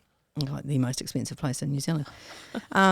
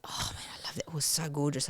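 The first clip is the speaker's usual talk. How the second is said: dreamy whisper; 10 dB quieter than usual. Retold or imitated. Retold longing for the horse.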